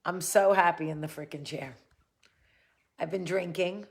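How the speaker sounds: ordinary delivery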